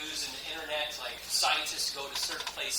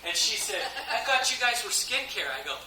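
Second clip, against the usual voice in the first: laughing retelling; 5 semitones higher than usual. Retold or imitated. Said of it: "I was like".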